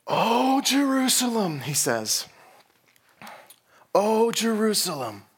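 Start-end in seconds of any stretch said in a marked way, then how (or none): 0.1-1.6 loud, high, drawn-out lament; acted wailing. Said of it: "He was wailing". 3.9-5.2 high, loud falling lament; acted wailing. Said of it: "He was wailing".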